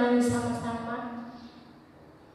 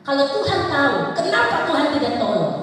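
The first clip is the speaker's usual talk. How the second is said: loud, raised protest to God; acted angry pleading; indignant challenge; 5 semitones higher, 7 dB louder than usual. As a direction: demand an answer as an aggrieved people. Acting Israel's angry challenge to God.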